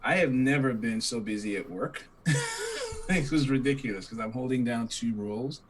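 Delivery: ordinary talk, nothing marked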